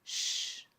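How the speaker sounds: soft hissed "Shh" to silence someone; hushing shh. Shown as a demonstration. Narrator showing the sh as hushing. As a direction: shush someone gently. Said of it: "como si estuviéramos mandando a callar".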